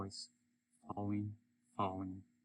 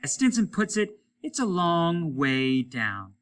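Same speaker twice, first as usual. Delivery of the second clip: taunting spaceman; 7 semitones higher, 6 dB louder than usual. Acted. Applegate taunting Hollis across space, mocking and raised. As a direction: needle him from far away. Character voice in story.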